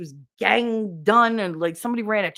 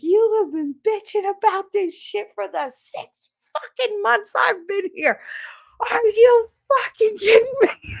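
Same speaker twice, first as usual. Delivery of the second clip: much higher, loud, cracking rant; furious retold yell; 13 semitones higher, 4 dB louder than usual. Yelling at the whining coworker after six months of complaints, voice cracking. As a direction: blow up at her with a breaking voice.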